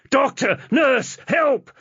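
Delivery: high, loud calls into the ward; shouting for help. Acted. Husband shouting for a doctor and nurse, loud and high. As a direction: yell for anyone to come. Yanks emergency cord, calls out.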